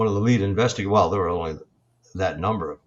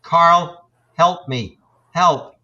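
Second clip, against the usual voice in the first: louder mimicked cry for help; mock plea for help, word by word; woman's voice; 5 semitones higher, 6 dB louder, much slower than usual. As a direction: imitate a recorded woman calling out in short bursts. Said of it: "you hear a woman's voice saying".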